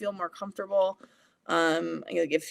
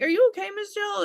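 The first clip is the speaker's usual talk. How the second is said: put-on high, louder little-kid question; child's voice pitched way up; concerned child; 13 semitones higher, 5 dB louder than usual. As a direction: imitate a small pupil checking on teacher. Acts out children asking teacher.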